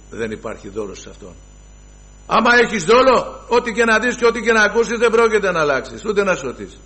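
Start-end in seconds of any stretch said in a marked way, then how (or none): 2.3-6.8 raised, pressing preacher's warning; forceful warning. Real, not acted. Preacher warning his flock, raised and urgent. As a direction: drive the warning home hard.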